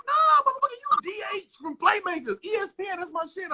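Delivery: high-pitched hyped fan shouting recognition; excited fan. Retold or imitated. A fan recognizing the TV star, high excited voice. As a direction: play a starstruck fan who just spotted him. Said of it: "They're like".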